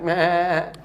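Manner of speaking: drawn-out mock sheep bleating. Lion cub who thinks he's a sheep, bleating. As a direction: bleat like a helpless lamb. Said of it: "lion sheep thing is like".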